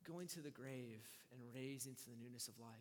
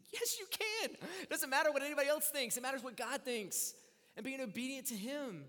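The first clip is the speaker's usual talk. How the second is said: much higher, louder insistence; firm reassurance; 10 semitones higher, 9 dB louder than usual. Retold or imitated. Pastor husband insisting to his wife, loud and firm. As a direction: insist loudly that she can. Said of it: "And I said".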